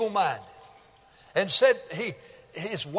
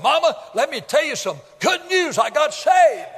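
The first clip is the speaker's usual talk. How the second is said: eager and higher, louder at the end; excited news; 7 semitones higher, 5 dB louder than usual. Retold or imitated. Son telling his mother he got saved, excited. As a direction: burst through the door with joy.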